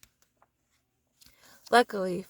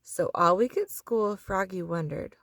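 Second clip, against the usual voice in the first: plain reading voice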